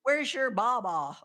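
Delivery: put-on slow, drawn-out Maine accent; mock Maine accent. Host teasing guest who grew up in Maine, in a slow mock Maine accent. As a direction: tease her with a Maine accent, slowly. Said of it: "I grew up in Maine".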